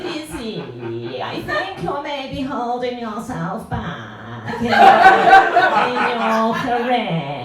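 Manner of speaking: very slow, drawn-out posh careers advisor; posh drawl. Retold or imitated. Mocking the careers advisor, slow posh drawl. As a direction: stretch every word like a creaking old door. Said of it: "that kind of drawl".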